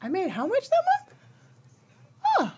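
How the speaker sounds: high, incredulous question at her own bank balance; mock disbelief. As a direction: replay the shock of seeing the total. Shocked at own bank account.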